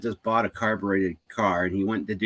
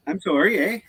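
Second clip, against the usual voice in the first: higher-pitched hammed-up Canadian apology; Canadian accent; 8 semitones higher than usual. Canadian joking about always saying sorry, exaggerated Canadian accent. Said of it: "that accent is, you don't hear it all the time".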